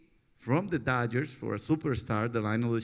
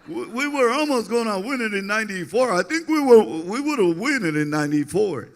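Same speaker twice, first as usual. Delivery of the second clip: higher, louder excited manager voice; imitating Felipe; 9 semitones higher, 8 dB louder than usual. [name] mimicking manager Felipe's excited speech. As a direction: do Felipe, climbing high and loud. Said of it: "That's how he talks".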